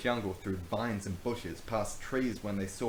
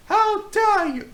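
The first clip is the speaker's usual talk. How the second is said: shrieking, slow, much higher and louder; scream; 15 dB louder, slower than usual. Acted. Rachel outraged at the tree slash, piercing scream. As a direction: scream it at the top of your lungs. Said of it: "Rachel screamed".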